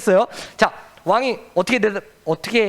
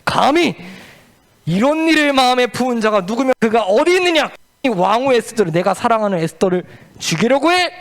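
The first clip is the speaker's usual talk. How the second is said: furious king; 5 semitones higher than usual. Acted. King demanding who plotted against his queen, furious. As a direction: thunder at whoever dared threaten her. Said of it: "화가 머리끝까지 팍 올라왔는데".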